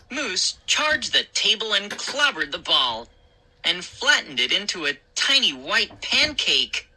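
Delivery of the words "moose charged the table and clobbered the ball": plain reading voice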